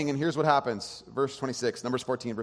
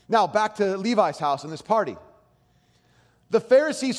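ordinary talk

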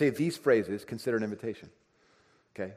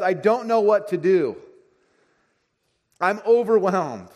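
higher, louder and slow, like someone at the end of their rope; overwhelmed friend; 8 semitones higher, 9 dB louder, slower than usual. Retold or imitated. Preacher voices a friend who feels overwhelmed, high, loud and slow. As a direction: stretch it out, overwhelmed and pleading.

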